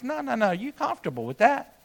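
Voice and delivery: quiet, knowing and fond; gentle voice of God. Retold or imitated. God gently telling the preacher he's too comfortable, soft and fond. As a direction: hush it like a patient father. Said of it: "And God says".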